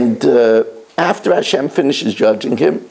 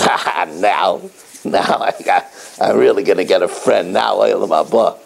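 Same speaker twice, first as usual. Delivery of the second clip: gleeful friend; 8 semitones lower than usual. Acted. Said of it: "he says".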